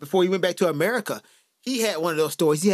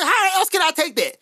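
incredulous retort; 8 semitones higher, 3 dB louder than usual. Retold or imitated.

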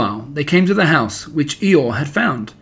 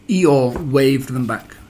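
ordinary delivery, nothing marked

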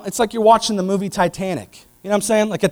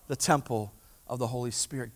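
ordinary talk, nothing marked

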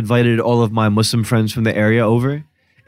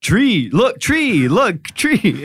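excited pointing; 6 semitones higher, much slower than usual. Retold or imitated. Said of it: "I was like".

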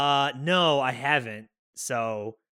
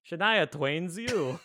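put-on higher, slow Russian-accented echo; mock Russian accent; 5 semitones higher, slower than usual. Friend tops the Soviet joke, mock Russian accent. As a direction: draw it out with a hammy Russian accent.

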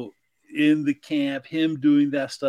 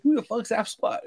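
high mock-Australian question; 9 semitones higher than usual. Actor reverted to Australian, baffled, in a high imitated Australian voice. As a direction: put on a baffled Australian, pitched up. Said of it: "in his Australian voice".

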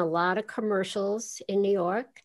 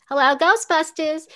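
Janine's voice, higher and louder; 8 semitones higher, 6 dB louder than usual. Shown as a demonstration. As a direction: give it the Janine receptionist snap, higher and louder. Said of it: "Yeah, like".

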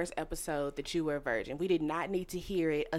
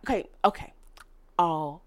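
usual speaking voice

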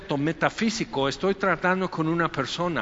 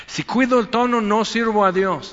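higher and louder, declaring it with conviction; forceful declaration; 5 semitones higher, 5 dB louder than usual. Retold or imitated. Preacher voicing Paul defending his harsh words, loud and higher. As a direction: proclaim it like Paul refusing to soften. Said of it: "no cuidé el tono".

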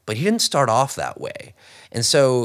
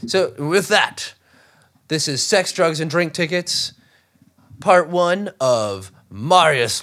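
announcer voice; 4 semitones higher, 4 dB louder, slower than usual. Acted. Dramatic episode title announcement.

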